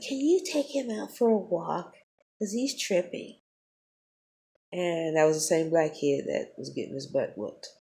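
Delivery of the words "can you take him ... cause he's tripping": high mock voice of the fake-polite teacher. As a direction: parody her sugary request, pitched up. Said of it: "She's like".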